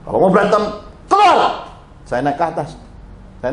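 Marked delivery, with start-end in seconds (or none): 0.1-1.7 louder, rising command; angry order. Retold or imitated. Pastor ordering the fighting couple out of his house. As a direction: order them out sharply.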